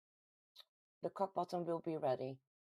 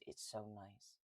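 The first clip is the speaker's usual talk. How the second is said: ordinary delivery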